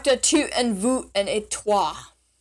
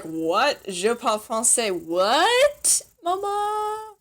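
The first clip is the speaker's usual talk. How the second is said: mock bafflement; 3 semitones higher, much slower than usual. Imagined learner baffled by French about mama, mock bafflement. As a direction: echo it in rising, exaggerated disbelief.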